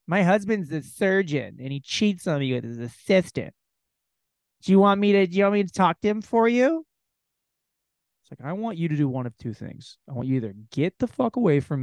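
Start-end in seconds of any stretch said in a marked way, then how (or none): 0.0-6.8 higher, louder fake-friendly woman; hammed-up woman's voice; mock woman's voice. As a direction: overeager stranger offering help. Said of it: "a woman's like".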